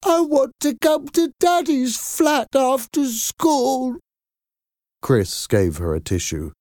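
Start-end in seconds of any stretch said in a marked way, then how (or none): 0.0-4.0 put-on high, stammering child on the edge of tears; a little girl who starts to cry and begs. Girl pleads with her parents, crying and stammering. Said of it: "she exclaimed".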